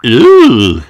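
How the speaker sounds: loud, wondering ooh; little girl impressed. Acted. Peppa marvels.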